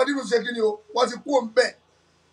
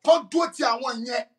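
ordinary delivery

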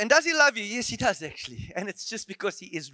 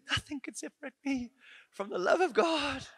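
mocking quote; 7 semitones higher than usual. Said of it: "Hey, it's like".

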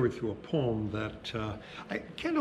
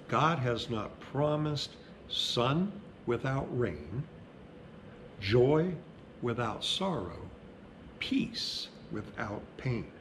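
reads the poem slowly, each line set apart; slow measured reading with pauses; slow poem reading at a funeral; slower than usual. Acted. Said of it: "So I'll read it slow".

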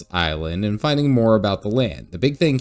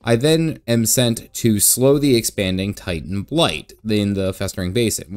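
ordinary delivery

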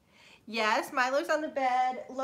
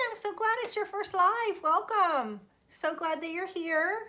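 bright welcome; 7 semitones higher than usual. Real, not acted. Greeting a first-time live viewer.

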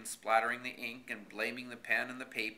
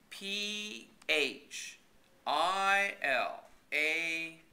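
letters spelled out slowly; slow spelling; 6 semitones higher, much slower than usual. A narrator's reading. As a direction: spell each letter deliberately. Spelling the word out.

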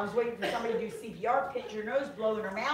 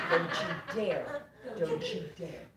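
low warning; 6 semitones lower, 5 dB quieter, slower than usual. Retold or imitated. Warning pastor not to speak tongues.

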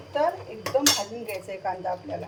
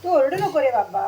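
higher, louder fond telling-off, quickening at the end; scolding the parrot; 4 semitones higher, 7 dB louder than usual. Real, not acted. Cook scolds her squawking parrot, higher and louder. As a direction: scold the bird sweetly but firmly.

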